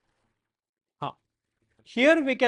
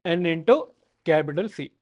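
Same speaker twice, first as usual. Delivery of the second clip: dictating. Shown as a demonstration. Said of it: "Next note".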